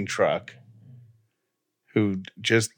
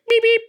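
very high, louder imitated friendly horn beeps; mock friendly honk; 5 dB louder than usual. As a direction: chirp it like a cheerful little car horn. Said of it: "you're like".

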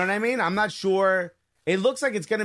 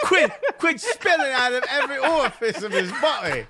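high giggly mock English voice; laughing; 8 semitones higher than usual. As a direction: laugh through it. Laughing mock Harry Kane.

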